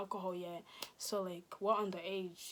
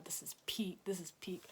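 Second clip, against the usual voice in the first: hushed dismay; 3 semitones lower, 8 dB quieter than usual. Retold or imitated. Dismay in the police car.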